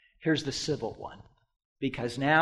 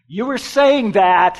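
raised, loud quoted declaration; emphatic retort; 4 semitones higher, 9 dB louder than usual. Retold or imitated. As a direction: throw the answer back loud and high. Emphatic re-voicing of Jesus' answer.

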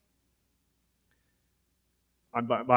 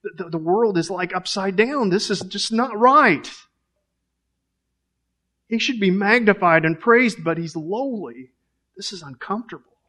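higher, pleading inner voice of Peter; higher-pitched Peter, upset and unsettled; Peter's inner protest; 5 semitones higher than usual. Acted. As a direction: voice Peter's shocked unease at the Messiah stooping. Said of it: "Peter's thinking".

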